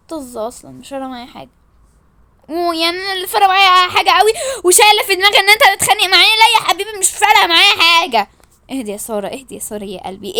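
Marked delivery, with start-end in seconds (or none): 2.5-8.3 loud, high, rapid comeback; indignant retort. Acted. Insisting she doesn't care about the fight, indignant retort. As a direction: fire back without a breath.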